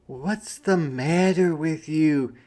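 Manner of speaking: a hint of a concerned mother; lightly voiced mom. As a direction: ask gently what's wrong. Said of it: "his mom asked".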